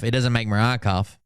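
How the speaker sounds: ordinary talk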